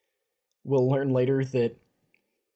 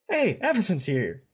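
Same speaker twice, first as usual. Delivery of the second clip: a bright, loud call that she has arrived; mock excited announcement; 7 semitones higher, 4 dB louder than usual. Telling the other host the angel has arrived, mock excited call. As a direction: cheer her entrance, half joking.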